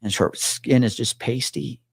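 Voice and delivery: normal talking voice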